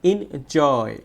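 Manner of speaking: second half of enjoy stretched and strong; stretched stressed syllable. Shown as a demonstration. Teacher showing students syllable stress, "joy" in enjoy drawn out. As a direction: lean hard and long on the "joy" in enjoy. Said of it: "জোরে উচ্চারণ করব".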